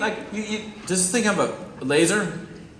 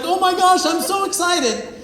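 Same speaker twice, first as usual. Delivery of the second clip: high-pitched, hammed-up gushing; exaggerated giddy delight; mock floating excitement; 11 semitones higher, 3 dB louder than usual. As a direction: squeal it like you're over the moon. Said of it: "now you're floating".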